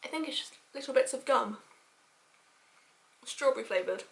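muffled behind fingers; talking while chewing; chewing gum, hand over mouth. Real, not acted. Seen 0: Hand covers mouth while tasting gum; brief drop at 3.6s.

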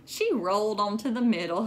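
ordinary delivery

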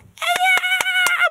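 very high, drawn-out squeal; kids' shrill cheer; mock cheer. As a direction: squeal like excited kids. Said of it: "막 이러는데".

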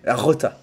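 "rota" with a rolled R. Shown as a demonstration. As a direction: roll the R in "rota". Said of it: "imaginez que vous allez faire un gros crachat". ("rota" avec un R roulé)

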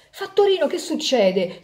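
high, loud alarmed cry at the conductor; shouting. Acted. Passenger on the bus calling the conductor, alarmed shout. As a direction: yell for the conductor, startled. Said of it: "si mise a gridare".

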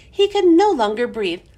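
plain reading voice

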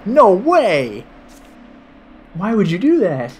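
delighted shock growing into glee; dawning joy. Real, not acted. Boss turns into fishman.

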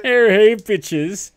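loud high greeting, hammed up; high hollered hello; mock shouted greeting.

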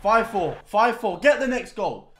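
ordinary delivery

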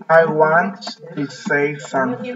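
slow, drawn out word by word for learners; slow model phrase. Shown as a demonstration. Teacher models the phrase for pupils to repeat, slowly. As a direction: stretch out WANT and pause between the words. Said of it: "también pueden decir".

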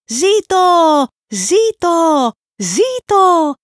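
loud, high chanted cheers; crowd cheering. A narrator's reading. The crowd hailing the new Pope of Fools, loud high cheers. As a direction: cheer it out like a whole hall roaring. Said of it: "αντιχούσε από παντού".

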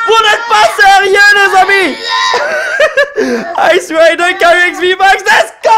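high, full-voice screaming disbelief; ecstatic shouting. Real, not acted. Collector to his friends on pulling Ice Rider, screaming ecstatically. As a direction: yell it out in wild excitement. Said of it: "il faut que je me calme".

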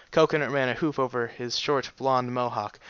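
ordinary delivery

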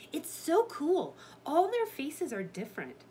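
high, loud marvelling; thrilled storybook girl; excited. A narrator's reading. Annie excited by clay army.

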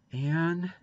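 small, high, plaintive call; pleading. A narrator's reading. Boy at the bedroom door to sleepy Anne, pleading. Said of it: "a pleading voice said".

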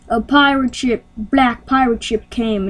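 ordinary talk

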